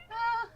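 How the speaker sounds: high, sudden gasp of shock. Acted. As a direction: gasp aloud at a horrible sight. Gasping at the president's corpse.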